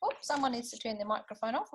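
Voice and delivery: ordinary delivery, nothing marked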